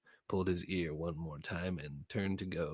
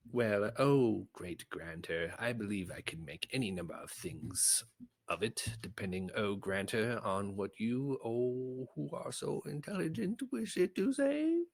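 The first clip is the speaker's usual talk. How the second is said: oily flattery; 4 semitones higher than usual. Acted. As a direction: lay the flattery on thick, rising. Sineas flatters the Grantor.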